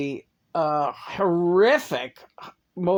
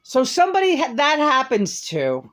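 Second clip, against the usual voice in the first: rising, louder indignant outburst; higher and louder as the anger builds; getting angry; 9 semitones higher, 4 dB louder than usual. Real, not acted. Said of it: "I'm getting angry now".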